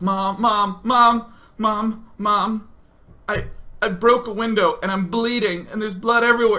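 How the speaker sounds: retold frantic cry for help; panicked. Bleeding son phones his mom at work, panicked.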